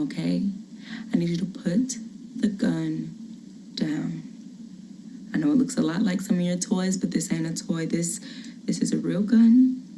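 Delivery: calm him down without scaring him; soothing mother. Acted. Mother coaxes child with gun.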